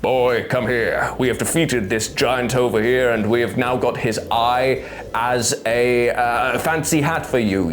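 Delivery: slow, put-on warrior voice; halting, mock-heroic god addressing his boy; ponderous tough guy, stumped and growling; Kratos. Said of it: "He's like".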